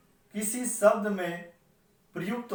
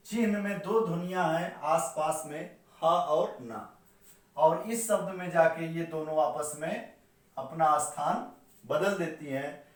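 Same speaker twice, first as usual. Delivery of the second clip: ordinary talk, nothing marked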